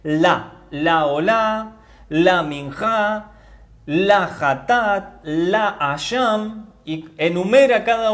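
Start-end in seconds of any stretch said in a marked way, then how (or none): 0.0-6.7 very slow, each "la" drawn out on an accented A; stress on LA. Shown as a demonstration. Teacher shows listeners the accented Hebrew article, "la" stressed. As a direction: hold "la" long and strong before each word. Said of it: "acento en la A, para que suene más fuerte".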